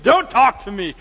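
loud grumpy don't-bother-me; irritated snap. Retold or imitated. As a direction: bark him away. Snapping at the other counselor.

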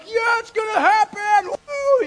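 cheering himself on; high loud woo; excited cheer. Retold or imitated. Kid hoping to win.

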